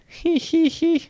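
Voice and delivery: very high nasal-free titter, sneering; high mocking giggle "he he he". A narrator's reading. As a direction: cackle up high at the brats. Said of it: "and then laughed again".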